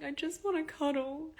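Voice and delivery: high, tearful voice breaking; crying while asking for a cuddle; teary plea. Retold or imitated. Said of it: "started, like, crying a bit".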